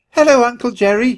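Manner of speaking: put-on high, loud childlike greeting; squeaked, eager hello; squeaky little nephew. A narrator's reading. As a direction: pipe up like an excited little mouse. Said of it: "he squeaked".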